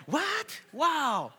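very high, loud mock shock; amazed exclamation. Family watches the handyman climb, loud high amazement. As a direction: burst out in exaggerated wonder.

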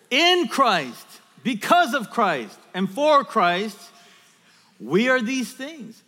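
rally the congregation with big, punched phrases; full-force preaching. Real, not acted. Preacher gets congregation proclaiming their identity, rousing loud preaching.

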